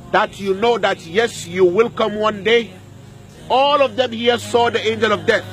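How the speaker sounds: ordinary delivery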